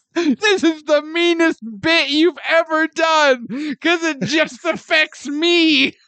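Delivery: high, slow laughing protest. Real, not acted. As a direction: break up laughing while complaining. Laughing, playful outrage.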